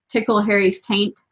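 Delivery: ordinary delivery, nothing marked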